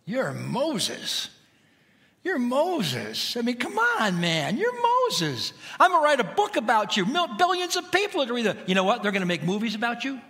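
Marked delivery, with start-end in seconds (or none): 2.2-3.4 higher, pumped-up hype man voice; hyped-up God. Acted. God hyping up Moses, excited and higher. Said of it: "And for God to say". 3.4-10.2 much higher, fast, gushing praise; hype-man God. Acted. God flattering Moses, fast excited hype. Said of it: "time to build him up".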